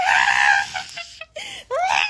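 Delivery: high laughing mock scream; imitated scream. Brother grabbed by Blackie, laughing mock screams. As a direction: scream like the little brother, cracking up. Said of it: "And he was screaming".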